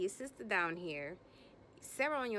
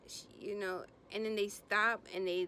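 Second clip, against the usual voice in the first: ordinary talk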